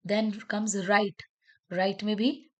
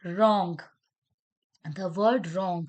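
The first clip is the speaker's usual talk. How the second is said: normal talking voice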